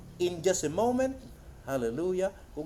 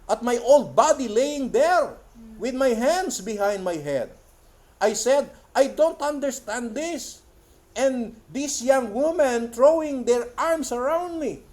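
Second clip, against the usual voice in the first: raised, slow preaching declamation; impassioned quote reading; 3 semitones higher, 4 dB louder, slower than usual. Acted. Preacher retelling a vision of his old body, impassioned declamation. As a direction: declaim it high and drawn out. Reads quoted testimony aloud.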